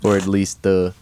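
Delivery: ordinary talk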